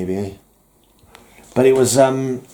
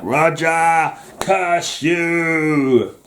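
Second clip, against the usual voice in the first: hammed-up cry of a comic villain, higher pitched; mock villain's curse; 5 semitones higher, much slower than usual. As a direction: draw out a theatrical curse at a rival. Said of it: "and I'll be like".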